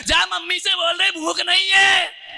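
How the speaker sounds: high, loud shout, slowing at the end; sulking husband shouts his refusal loud enough for his wife; loud angry reply. Retold or imitated. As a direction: bellow it so the wife hears too. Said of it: "अरे, इतना जोर से बोल रहा".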